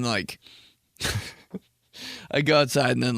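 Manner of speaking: usual speaking voice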